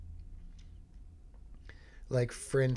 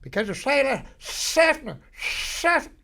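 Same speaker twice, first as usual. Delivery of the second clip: high, loud bursts of "Seffner"; 14 semitones higher, 8 dB louder, slower than usual. Shown as a demonstration. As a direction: yell SEFFNER at the top of your lungs. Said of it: "Say it to the top of your lungs".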